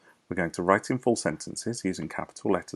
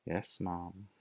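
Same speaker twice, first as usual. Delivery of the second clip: meek servant; 10 dB quieter than usual. Acted. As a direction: answer humbly like a servant. Mabel meek reply.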